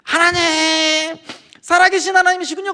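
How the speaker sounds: drawn-out, high crying shout; tearful wail. Retold or imitated. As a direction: reenact wailing to God, stretching the first word. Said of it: "눈물 콧물 쏟으면서 거기서 막 우는". (울부짖듯 높고 길게 외치며)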